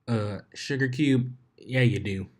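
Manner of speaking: a hint of a Southern farm girl drawl. Applejack teasing Pinkie in a light Southern drawl. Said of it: "A southern drawl chimed in".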